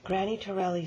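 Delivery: plain reading voice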